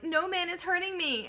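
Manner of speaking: high, loud Cyclops cry; anguished yell. Acted. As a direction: yell it out in pain and panic. Blinded Cyclops yelling for help.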